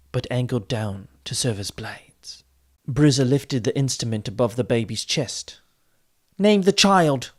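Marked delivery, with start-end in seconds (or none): 6.4-7.3 urgent plea, much higher; imploring. A narrator's reading. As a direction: beg her mother to name it now. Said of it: "she implored her mother".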